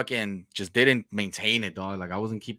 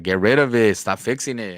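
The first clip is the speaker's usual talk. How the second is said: louder, insistent order; nagging wife; 11 dB louder than usual. Retold or imitated. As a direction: demand it firmly. Wife nagging about truck.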